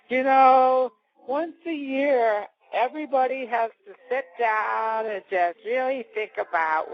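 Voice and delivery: slow, drawn-out singsong sermonizing; syrupy parody of giving thanks; mock sentimental speech. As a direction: stretch it out like a sappy holiday toast.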